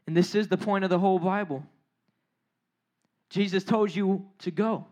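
low, subdued wonder; hushed preaching. Real, not acted. Preacher sharing the best news, low and earnest. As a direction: drop down low and let it sink in.

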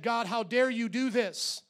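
David voiced high and loud, ranting at God; angry complaint. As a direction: wail it at God like a spoiled child. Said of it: "he said".

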